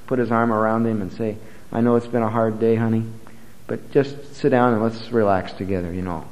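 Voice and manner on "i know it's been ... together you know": softer and lower, soothing a tired husband; tender wife. Pretend. Loving wife comforting Jeremiah home from preaching, soft and tender. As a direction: gently ease him down after a hard day.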